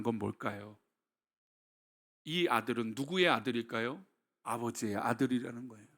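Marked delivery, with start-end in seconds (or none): none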